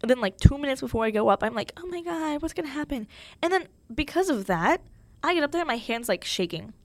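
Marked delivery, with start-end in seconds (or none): 1.8-3.1 high, hushed mock panic; retold nervous panic. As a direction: quietly fret in a raised pitch, imitating nerves. Retold nerves before the speech.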